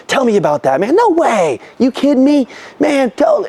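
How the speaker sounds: animated, voice climbing high in disbelief; excited. Shown as a demonstration. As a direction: react like a thrilled, amazed listener. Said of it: "I'm like, I'm excited".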